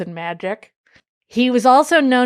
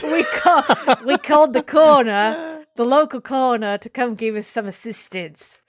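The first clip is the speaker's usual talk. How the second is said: put-on Australian accent; 3 semitones higher than usual. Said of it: "I can't control it".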